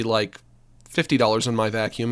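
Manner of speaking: normal talking voice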